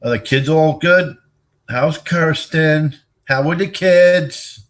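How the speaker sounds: shouting slow questions to someone far off; loud call. Real, not acted. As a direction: call across the room, drawn out. Calling questions to distant person.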